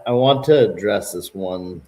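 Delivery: ordinary talk, nothing marked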